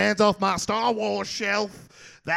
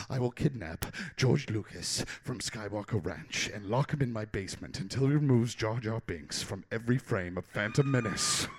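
much lower, softer, sinister threat; low conspiratorial voice; 11 semitones lower, 11 dB quieter than usual. Acted. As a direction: drop it low like a villain's secret plan. Sudden drop to low voice.

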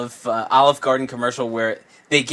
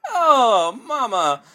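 higher Italian accent, lightly voiced; jolly italian pizza chef; 5 semitones higher than usual. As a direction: marvel like an Italian. Italian voice praising American pizza.

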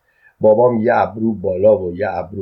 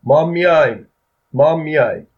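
higher, louder eager shout; 6 semitones higher, 4 dB louder than usual. A narrator's reading. Men in the crowd shout to join, loud and high. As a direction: shout out to be included. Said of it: "چند نفر دیگه هم داد زدن".

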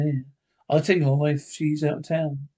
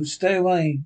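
usual speaking voice

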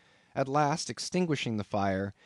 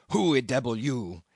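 gruff broken English of the harpooneer, in a different timbre; rough, foreign-sounding cannibal demanding who is in his bed; Queequeg's voice; 4 dB louder than usual. A narrator's reading. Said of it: "he said at last".

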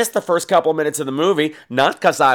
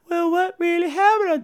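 high put-on young woman's voice; high girlish voice. Danielle Harris's character asks Tony Todd, high girlish. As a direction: ask it wide-eyed in a high voice. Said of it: "Danielle Harris is all like".

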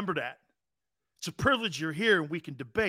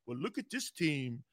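mock old radio guy; 6 dB quieter than usual. Host mocks radio pundits, a light smug pundit voice. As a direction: mimic a pundit gloating over a lamp. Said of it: "I rub and go".

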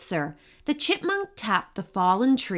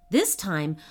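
plain reading voice

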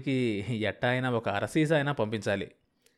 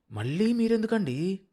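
surprised question; 7 semitones higher than usual. A narrator's reading. Wife questions husband sharply.

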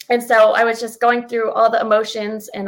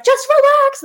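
much higher, louder mock "Just relax"; mock calming cry; 6 dB louder, slower than usual. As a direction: squeak "Just relax" way up high and loud. Retold film scene, calming Ron.

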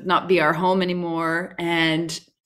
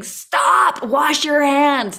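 put-on high, loud panicked yell to the kids; screamed warning; 9 semitones higher, 5 dB louder, much slower than usual. Mother yelling at kids about germs, high panicked shout. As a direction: shout it like a frantic germ-obsessed mom. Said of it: "notes around the house screaming".

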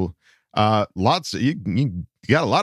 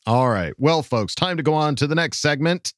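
ordinary talk, nothing marked

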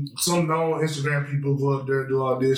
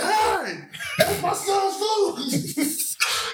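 high, drawn-out protest of an outraged dad; mock angry dad; much slower than usual. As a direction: squeal it out slow like a wronged father. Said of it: "the daddy said".

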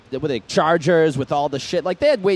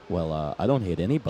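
deadpan driver; 11 semitones lower, 7 dB quieter than usual. Retold or imitated. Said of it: "He's like".

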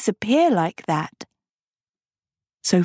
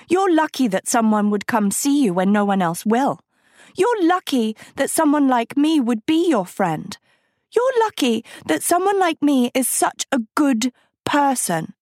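eyes flashing; 7 semitones higher than usual. A narrator's reading. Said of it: "Sophie's eyes flashed".

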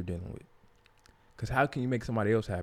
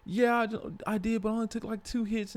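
mimicking her; 11 semitones higher than usual. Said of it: "She like".

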